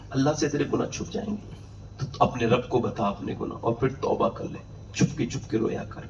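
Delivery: soft, whispered sermon plea; tender whispered call to repent; hushed preaching. Acted. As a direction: draw the listeners in with a whisper. Preacher softly urging repentance.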